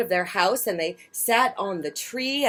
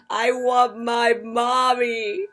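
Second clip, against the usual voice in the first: slow, higher drawn-out plea; littlest owlet; 5 semitones higher, 4 dB louder, slower than usual. A narrator's reading. Lightly voiced baby owl Bill pleading, slow. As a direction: stretch out the wish, missing mama. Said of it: "said Bill".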